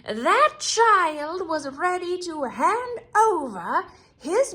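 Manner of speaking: high, slow, loud witch voice; a menacing witch claiming her prize. Acted. Witch's voice, demanding the traitor.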